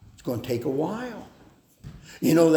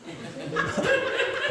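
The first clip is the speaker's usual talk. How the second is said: a sudden high laugh; high burst of laughter. Real, not acted. Laughs at own example.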